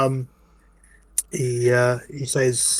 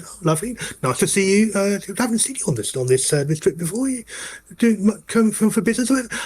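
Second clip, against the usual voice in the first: chirpy polite conductor; 7 semitones higher than usual. Acted. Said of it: "He said".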